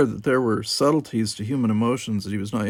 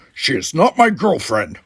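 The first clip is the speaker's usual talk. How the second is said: louder, higher indignant denial; 5 semitones higher, 7 dB louder than usual. Acted. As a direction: reject it with offended pride. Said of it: "Worf said indignantly".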